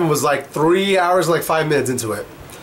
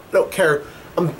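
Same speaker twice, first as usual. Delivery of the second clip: usual speaking voice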